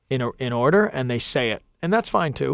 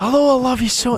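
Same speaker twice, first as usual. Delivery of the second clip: mock French romance; 10 semitones higher, 4 dB louder than usual. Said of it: "it's like the French".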